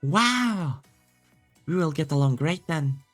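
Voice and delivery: high, bright Wow; cheerfully thrilled; excited. Acted. Reading an excited character.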